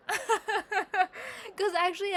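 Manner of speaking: slow words through a real laugh. Real, not acted. Telling the eyebrow story, breaking into real laughter.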